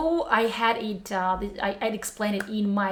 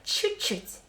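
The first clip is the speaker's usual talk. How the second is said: Чуть-чуть said softly as a modest reply; sample answer; 5 semitones higher, 5 dB quieter than usual. Shown as a demonstration. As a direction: answer "a little" lightly, almost breathed. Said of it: "Do you speak Russian".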